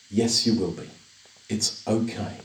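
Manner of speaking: calm a frightened mother gently; soft reassurance. Retold or imitated. Son reassuring his mum she'll be saved, tender and soft.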